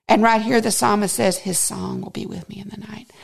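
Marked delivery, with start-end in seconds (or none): 1.4-3.1 soft quote. Real, not acted. Quoting verse softly.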